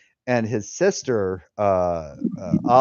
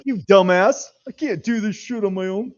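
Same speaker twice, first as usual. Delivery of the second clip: mock scolding wife, much higher and louder at first; imitating wife; 8 semitones higher, 4 dB louder than usual. Imitating his wife scolding him, mock higher voice. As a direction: ham up the exasperated wife. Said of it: "She's like".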